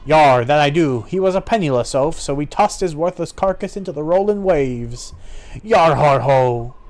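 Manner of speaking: callous sea-dog swagger; gloating pirate. Acted. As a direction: relish it like a roguish pirate.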